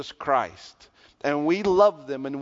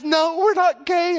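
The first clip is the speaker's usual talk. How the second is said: much higher, louder and slow, insisting; shouted denial; 13 semitones higher, 6 dB louder than usual. Retold or imitated. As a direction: belt it out the second time, now exasperated. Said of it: "and he says".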